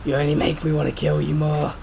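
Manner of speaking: ordinary talk, nothing marked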